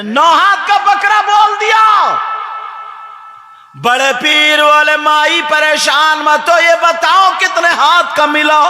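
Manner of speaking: loud, high preaching voice; fervent preaching. Acted. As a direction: cry it out to the crowd. Preaching at full force.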